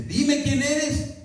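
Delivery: high, slow insistent demand; wife demanding. Retold or imitated. Wife pressing the extortion caller for a name, slow high demand.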